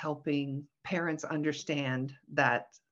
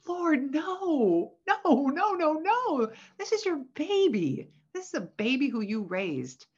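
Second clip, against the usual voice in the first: acted pleading; 9 semitones higher, 4 dB louder than usual. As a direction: plead with a mom in dismay. Pleading with moms who cut off.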